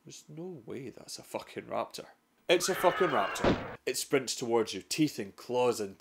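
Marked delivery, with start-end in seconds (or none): 0.1-2.1 barely breathing it, sure it can't be real; hushed disbelief. Pretend. Explorer whispering to himself at the rustle, hushed. 2.5-3.8 punchy reveal. Acted. Narrator confirming the raptor to viewers, punchy. Reveal after hushed denial.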